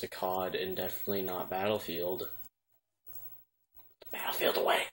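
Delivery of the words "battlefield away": forceful stage-whispered cry; whispered mock shout. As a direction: call it out unvoiced, like a hushed battle cry. Acted cry about the game.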